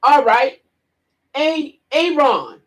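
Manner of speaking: slow, stretched calling of the name; a teacher calling out a name slowly; teacher's drawn-out roll call. Retold or imitated. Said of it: "So the teacher says".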